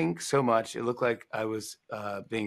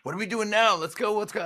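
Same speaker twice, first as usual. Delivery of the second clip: much higher, eager mock voice; eager president; 9 semitones higher than usual. Energetic president urging everyone along, higher eager voice. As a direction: pump it up like an impatient boss. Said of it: "He's like".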